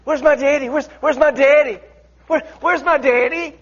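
put-on high child's pleading; little girl asking for daddy. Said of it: "Little girl".

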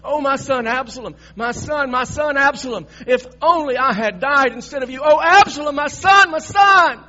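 high, loud anguished lament rising to a shout; grief building to shout. Acted. King David crying out for dead Absalom, high wail building to a shout. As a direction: keep wailing and let it swell into a full cry. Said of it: "He's quivering and he's shaking and he's crying".

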